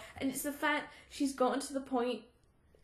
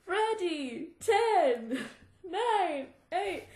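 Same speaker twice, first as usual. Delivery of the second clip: countdown call; 6 semitones higher, much slower than usual. Retold or imitated. Counting down in retold scene.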